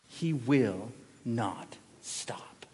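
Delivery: slow ominous whisper; hushed dread; whispered warning. Acted. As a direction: lean in and whisper it slowly.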